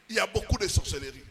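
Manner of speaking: usual speaking voice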